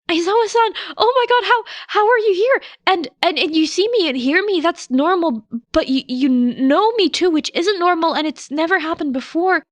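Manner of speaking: put-on high, loud tumbling boyish voice; a stammering kid, overjoyed and bewildered; breathless high boy gushing questions; young boy, excited. Narrator voices Midoriya.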